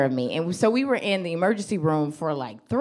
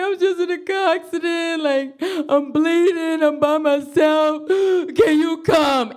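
high, drawn-out tearful wail with a gasp; hammed-up sobbing plea for help; mock crying; 7 semitones higher than usual. Crash victim phoning the girl for help, mock crying. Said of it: "And I'm crying".

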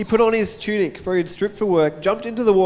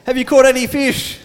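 raised call across the water; 7 semitones higher, 5 dB louder than usual. Retold or imitated. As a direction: call out to the boat. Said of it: "He called out".

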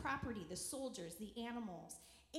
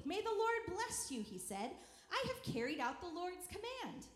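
higher-pitched, cheerful greeting; 6 semitones higher than usual. A narrator's reading. Saul greeting Samuel, cheerful. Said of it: "Saul greeted him in cheer-, uh, cheerfully".

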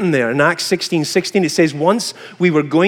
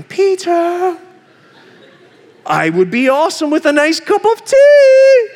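high, louder, sing-song lure from the fridge; tempting cake voice; 10 semitones higher, 3 dB louder than usual. Retold or imitated. Chocolate cake calling Peter from the fridge, high and luring. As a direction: coax him like a cake that talks. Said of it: "it just starts talking to you going".

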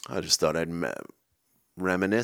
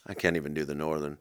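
normal talking voice

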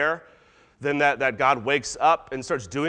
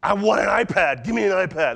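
loud, high mock tantrum; demanding kid; 6 semitones higher, 5 dB louder than usual. Kid demanding an iPad, entitled mock tantrum. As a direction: insist like a child stamping out each demand. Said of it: "when the kid says".

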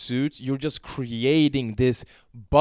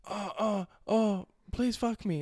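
much higher, slow begging voice; mock begging girl; 10 semitones higher, 3 dB quieter, slower than usual. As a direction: plead like she can't wait.

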